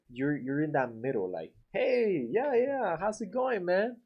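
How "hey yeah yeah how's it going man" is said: higher, easy, relaxed hello; casual friendly. Shown as a demonstration. Painter greets homeowner casually, higher and relaxed. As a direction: greet them casual but not overdone. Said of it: "Like that".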